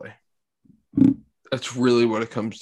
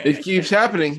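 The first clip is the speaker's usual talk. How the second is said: laughing through hiccups; 7 semitones higher, 4 dB louder than usual. Real, not acted. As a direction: hiccup breaks the words. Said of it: "Derek keeps hiccuping".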